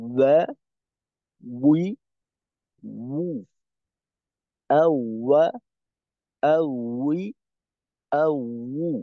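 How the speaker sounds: "ওয়া", "উই", "উ" with rounded lips, as Arabic waw syllables; waw demo. Shown as a demonstration. Teacher modelling the rounded-lip waw for students. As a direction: say "আ, ওয়া" with lips kept round, each sound clear. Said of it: "প্রকৃতপক্ষে ওয়াও এর উচ্চারণটাই হলো ওইরকম".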